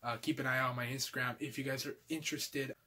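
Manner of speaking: ordinary talk, nothing marked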